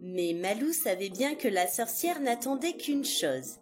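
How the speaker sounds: ordinary delivery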